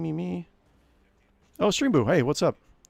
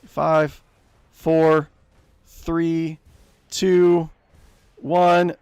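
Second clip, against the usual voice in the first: tense countdown; 4 semitones higher, 7 dB louder, much slower than usual. Real, not acted. Counting down the repair.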